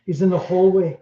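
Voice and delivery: lightly hammed-up Scottish medium sensing a spirit; mock Scottish psychic. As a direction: channel a ghost in a put-on Scottish accent. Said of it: "I'm not even doing it right".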